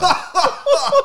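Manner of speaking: sudden squealing laugh; high burst of laughter. Real, not acted. Laughing at own story.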